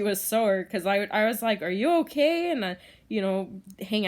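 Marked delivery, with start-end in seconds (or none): 1.6-2.5 higher, caring check-in; concerned. Real, not acted. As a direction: gently ask the pet. Asking sore hedgehog.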